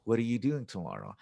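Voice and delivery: quick "What are you", sounds swallowed; brisk connected speech, "What are you" reduced; natural reduced cadence. Shown as a demonstration. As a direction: run "What are you doing tomorrow" together quickly. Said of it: "They disappear in English".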